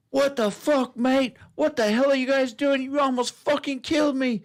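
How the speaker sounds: high-pitched imitation of an irate biker; retold angry yelling. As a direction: act out his outraged yell, pitch way up. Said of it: "kept screaming and yelling".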